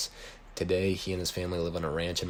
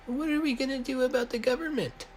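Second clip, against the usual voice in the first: much higher falsetto, a little louder; Riley's voice; 3 dB louder than usual. A narrator's reading. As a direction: squeak it out way up high like a nervous kid. Riley voiced in story dialogue.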